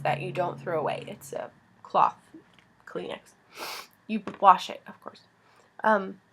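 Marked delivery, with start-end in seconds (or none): none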